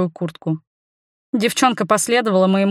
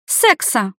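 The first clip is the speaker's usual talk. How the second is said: put-on defiant, raised girlish reply; higher-pitched bold retort; loud answer; 4 semitones higher than usual. A narrator's reading. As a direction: blurt it out boldly. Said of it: "громко ответила".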